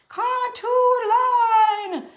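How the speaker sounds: high, loud, stretched-out answer; student proudly answering. Acted. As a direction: sing it out to the dinner table. Acts out dinner-table answer to parent.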